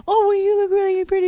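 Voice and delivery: put-on high, fast flustered boyfriend; high mock backpedal. Boyfriend backpedalling to his girlfriend, high and flustered. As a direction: squeak it out like an excuse. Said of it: "And he goes".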